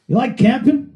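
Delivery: high, sly put-on voice; voicing Piero. Retelling, a high sly question as the bandmate. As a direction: ask it high like the cheeky bandmate.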